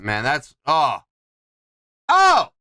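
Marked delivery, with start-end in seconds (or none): 2.1-2.5 excited exclamation. Real, not acted. Reacting to the music.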